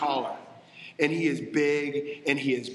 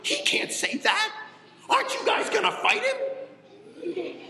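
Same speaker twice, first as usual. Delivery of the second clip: put-on squeaky, much higher kid voice with a laugh; pipsqueak David; slower than usual. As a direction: play an indignant little pipsqueak. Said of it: "little pipsqueak David's like".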